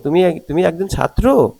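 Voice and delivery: astonished check, high swoop on "ছাত্র"; surprised question. Shown as a demonstration. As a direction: pitch lifted, jumping up on "ছাত্র". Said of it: "অবাক হচ্ছে".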